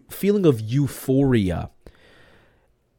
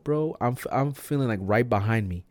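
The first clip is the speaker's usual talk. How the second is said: ordinary delivery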